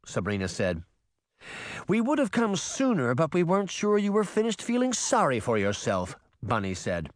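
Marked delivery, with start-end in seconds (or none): 1.9-6.2 much higher, haughty queen voice; high-pitched sarcastic jab; scornful wicked queen. A narrator's reading. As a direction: needle him in a high, cutting tone. Bunny, the wicked queen, sniping.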